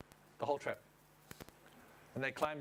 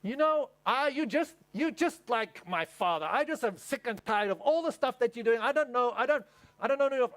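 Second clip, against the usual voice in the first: higher, louder mock exasperation; upset wife; 6 semitones higher, 4 dB louder than usual. Wife confronting husband to connect, mock exasperated. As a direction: vent like the fed-up wife. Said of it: "She goes".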